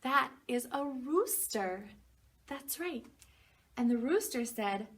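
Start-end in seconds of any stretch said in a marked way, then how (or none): none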